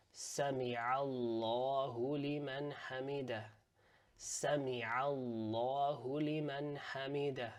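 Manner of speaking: slow, measured recitation of the prayer. Shown as a demonstration.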